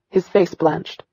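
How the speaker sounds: usual speaking voice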